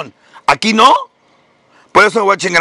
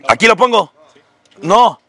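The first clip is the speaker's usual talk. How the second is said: ordinary delivery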